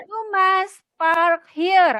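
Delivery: slow teacher demo; slow model reading. Shown as a demonstration. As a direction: read it slowly for the class to repeat. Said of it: "Ayo dibaca".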